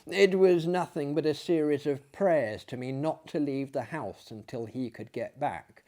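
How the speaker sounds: usual speaking voice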